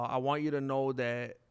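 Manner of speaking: ordinary talk, nothing marked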